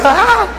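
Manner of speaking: high, loud yelp; mock child's cry of pain. Child slapped by mother, short yelp. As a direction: cry out like a child hit twice.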